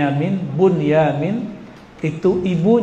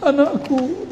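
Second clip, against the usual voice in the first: high wailing call Anakku; a touch of a mother's sob; mock crying mother; 8 semitones higher, slower than usual. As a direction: imitate a weeping mother calling her child. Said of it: "nangis-nangis".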